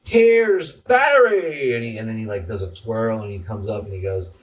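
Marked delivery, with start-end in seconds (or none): 0.0-1.7 big high slow announcer call; announcer intro. Retold or imitated. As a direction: sing out an intro jingle like a show host. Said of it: "and it's like".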